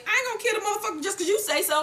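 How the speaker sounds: high, fast, defiant retort; defiant Tommy. Retold or imitated. Recapper voicing Tommy refusing Milan, high and fast. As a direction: rattle it off in mock defiance. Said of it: "And Tommy says".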